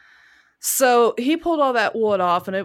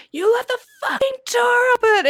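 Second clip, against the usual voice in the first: much higher, louder panicked scream; 5 dB louder than usual. Retold or imitated. Wife screaming at husband about the open door. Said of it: "I screamed so loud".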